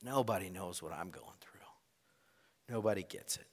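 hushed, near-whispered lament of loneliness; under the breath. Retold or imitated. Preacher voicing a lonely listener, muttered under breath. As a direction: breathe it out like a private complaint. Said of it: "and we say ... maybe even under our breath".

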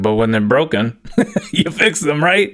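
chuckling through the words; amused, rising right at the end; laughing retort. Retold or imitated. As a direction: laugh at how obvious it is. Laughing at his own retort.

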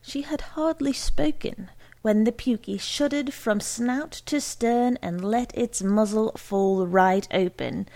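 ordinary delivery, nothing marked

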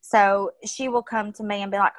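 ordinary talk

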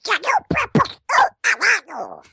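angry Pato Donald. Acted. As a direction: sputter it like an enraged cartoon duck. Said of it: "como o Pato Donald irritado".

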